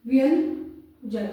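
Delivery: व्यंजन drawn out slowly; slow key word. Shown as a demonstration. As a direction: announce व्यंजन slowly, syllable by syllable. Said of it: "अपने व्यंजन के ऊपर". (व्यंजन शब्द को धीरे, खींचकर बोलना)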